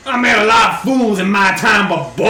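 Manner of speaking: loud, exasperated put-down, dropping at the end; hammed-up disbelief; scornful adventurer. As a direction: berate a fool in character. Player insults archer in character.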